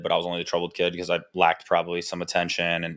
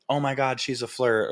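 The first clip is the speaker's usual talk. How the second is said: higher-pitched imitation of cooing onlookers; mock gushing; 6 semitones higher than usual. People commenting on a ten-month-old baby, mock gushing. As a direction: lift the voice to mimic a gushing admirer. Mimics the same admirers again.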